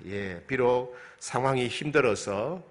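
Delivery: usual speaking voice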